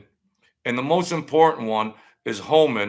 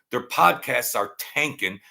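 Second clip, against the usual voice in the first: ordinary talk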